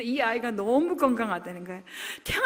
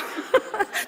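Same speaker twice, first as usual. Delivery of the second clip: choked sob-laugh; 9 semitones higher than usual. Retold or imitated. Tearful outburst during retelling.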